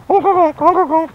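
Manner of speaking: very high honking bird call; bird honk imitation. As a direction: honk like a silly clown bird. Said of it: "They go".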